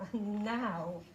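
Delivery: plain reading voice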